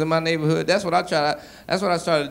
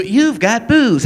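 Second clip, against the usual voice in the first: higher, slow mail alert voice; computer voice; 7 semitones higher, 6 dB louder, much slower than usual. Retold or imitated. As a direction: announce it like the email alert.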